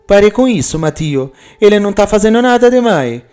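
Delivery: wife scolding her jealous husband, high and quick. Acted. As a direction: snap at him to stop, high and quick. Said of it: "Juliana briga".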